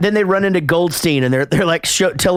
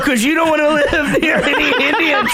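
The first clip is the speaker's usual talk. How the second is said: laughing tease; 10 semitones higher than usual. Real, not acted. Teasing co-host with laughter.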